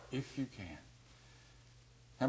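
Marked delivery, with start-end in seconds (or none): 0.1-0.8 much softer, dropping away; soft echo. Shown as a demonstration. Preacher echoes the father's doubt, much softer. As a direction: let it sink to a murmur.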